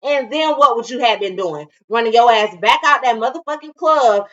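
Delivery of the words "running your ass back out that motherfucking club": usual speaking voice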